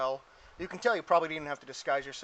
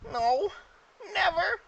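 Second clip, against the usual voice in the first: high, loud goblin voice; goblin reply; 5 dB louder, slower than usual. Acted. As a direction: squeak it out as a goblin. Goblins answering the player.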